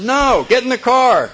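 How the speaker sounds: loud, high, curt command; sponsor's loud no-nonsense order; barked order. Retold or imitated. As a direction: shout him down. Sponsor cuts him off.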